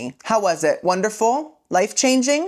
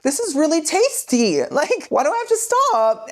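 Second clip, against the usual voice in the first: much higher, mock-dreamy vampire voice; greedy Edward; 9 semitones higher than usual. Edward sucking out the venom, mock-dreamy and much higher. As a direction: savour it like a guilty snack. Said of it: "he's like".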